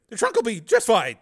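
high, loud nervous agreement; anxious hasty reply. Acted. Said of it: "Shelley replied quickly".

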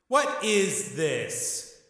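shouted. Acted. Gangster demands an explanation from the seats, shouted. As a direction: shout it in angry confusion. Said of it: "Tommy shouted".

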